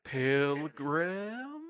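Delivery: Henry voice. Acted. Player finding a character's voice, low English accent. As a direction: try out an English character softly. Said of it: "I have to do a Henry voice".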